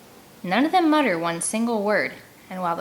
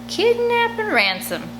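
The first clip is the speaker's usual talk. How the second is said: put-on boy's eager voice; 14 semitones higher, slower than usual. Junior repeating idea.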